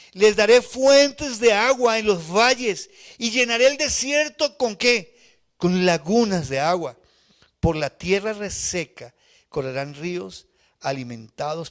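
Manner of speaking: slow, high, loud scripture reading; preaching at full force. Real, not acted. Preacher reading Isaiah to the church, slow and loud. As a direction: proclaim each promise to the crowd.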